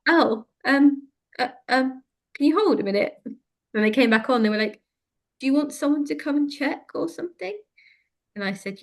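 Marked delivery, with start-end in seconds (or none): 0.1-3.1 mock flustered operator, higher voice; flustered call handler. Mimicking flustered hospital call handler. 5.4-7.6 mock flustered operator, higher voice; flustered call handler. Mimicking flustered hospital call handler.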